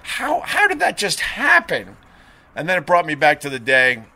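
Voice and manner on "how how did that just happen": stunned disbelief. Retold or imitated. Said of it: "I was like".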